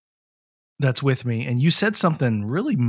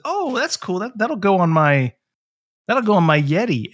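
delighted; 6 semitones higher, 3 dB louder than usual. Retold or imitated. Retelling his own delighted reaction.

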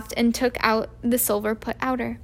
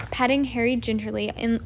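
ordinary delivery, nothing marked

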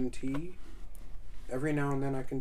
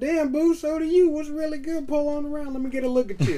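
half country; 7 dB louder than usual. Retold or imitated. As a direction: lay on a half-country flirt. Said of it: "I went half country on that one".

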